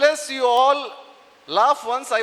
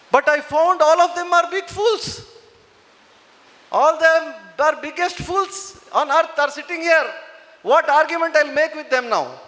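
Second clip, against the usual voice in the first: higher, louder scornful retort; a boy mocking the scholars, raised voice; 5 semitones higher, 5 dB louder than usual. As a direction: scoff at the fools. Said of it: "Then he says one beautiful".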